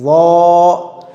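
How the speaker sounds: "वा" as a heavy Z, rounded and held long; thick-sound demo. Shown as a demonstration. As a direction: hold "वा" full on a level tone. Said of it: "आवाज़ मोटी होगी". ("वा" को मोटा करके खींचें)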